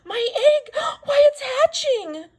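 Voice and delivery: very high, loud tearful shout; Horton's high tearful cry; shouted, near tears. A narrator's reading. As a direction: cry out, overwhelmed with joy. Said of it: "shouted Horton".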